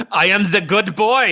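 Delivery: slow, high-pitched mock Russian-German dog voice; German-turned-Russian accent. Co-host voicing the dog boasting, in a botched German accent. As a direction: play a proud dog in a cheesy Russian accent. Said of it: "mine st-, was intentioned to be German".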